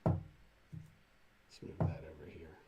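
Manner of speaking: soft, breathy whisper to self; slow whisper under the breath; whispered aside. Real, not acted. Whispered aside while working.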